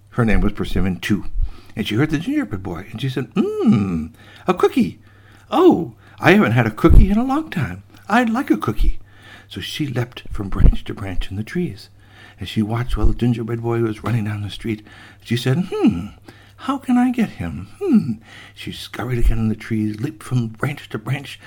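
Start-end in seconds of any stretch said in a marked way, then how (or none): 3.3-9.0 high, longing cat voice; hungry old cat. Acted. Hungry cat spots a cookie. 15.7-18.1 high, slow musing purr; scheming cat. Acted. Cat plots to catch cookie.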